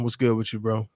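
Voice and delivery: usual speaking voice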